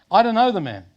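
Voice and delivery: loud, high-pitched outburst of denial; direct denial. Acted. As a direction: blurt it out loudly, pitched well up. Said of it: "the lie is more direct".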